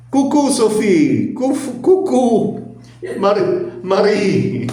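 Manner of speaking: high, slow, sing-song "Cucu" with a laugh; playful cuckoo greeting. Shown as a demonstration. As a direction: greet her like a cuckoo clock, high and teasing. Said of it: "tem também agora cucu".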